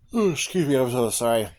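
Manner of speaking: talking through a yawn. Real, not acted. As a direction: yawn while apologizing. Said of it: "I yawn a lot".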